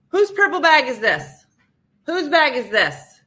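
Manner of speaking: loud, high demand from the aisle; loud call. Retold or imitated. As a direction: call out to the whole cabin. Flight attendant calling out.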